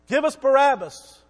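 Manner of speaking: preacher voicing the mob crying for Barabbas, loud; crowd demanding. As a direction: bellow it like a baying crowd.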